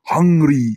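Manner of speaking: "Hungry" hit hard with a stretched ending; emphatic, hungry "hungry"; ravenous. Shown as a demonstration. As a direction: say "hungry" as if starving. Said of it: "তোর প্রচণ্ড খিদে পেয়েছে".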